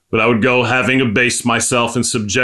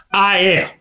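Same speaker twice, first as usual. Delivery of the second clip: emphatic insistence; 8 semitones higher than usual. Real, not acted. Insists on own wretchedness.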